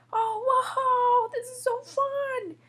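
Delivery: high, delighted cry; thrilled exclamation. Acted. Julian is let loose in the park, thrilled exclamation. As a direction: burst out with glee.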